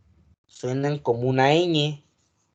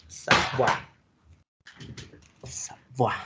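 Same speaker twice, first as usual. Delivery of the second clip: whispered practice; 4 dB quieter, much slower than usual. Shown as a demonstration. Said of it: "se pronuncia una A".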